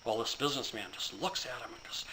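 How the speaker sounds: ordinary delivery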